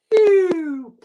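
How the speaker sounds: high, loud descending squeal; high-pitched sliding sound effect; falling whistle noise. Retold or imitated. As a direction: imitate something plummeting with a high "eeew". Imitates show going down toilet.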